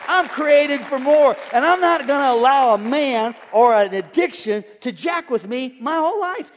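loud, high triumphant proclamation; bold declaration. Pretend. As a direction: declare it with full preaching fire. Declaring boldly at full preaching force.

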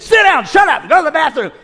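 high, loud barked command; mock shouted order. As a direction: imitate the rude steward yelling. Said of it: "when the guy said".

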